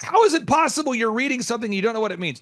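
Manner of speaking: high-pitched imitated scolding; retold aggressive exasperation. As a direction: act out a fed-up pastor grilling a pupil. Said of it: "he's so aggressive".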